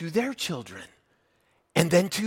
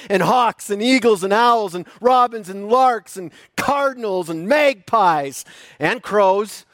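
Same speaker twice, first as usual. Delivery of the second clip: booming biblical narration; 5 semitones higher, 6 dB louder than usual. Acted. Builds the creation list.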